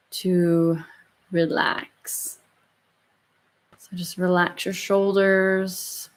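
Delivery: slow, soft meditation voice; calm and unhurried, gently guiding; guided meditation. Acted. Leads the closing meditation.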